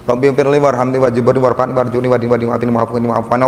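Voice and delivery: gabbled fast, without feeling; rushed recitation. Shown as a demonstration. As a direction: rattle it off mechanically, like a chore. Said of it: "kalau kita bacanya gini, coba ibu bandingkan".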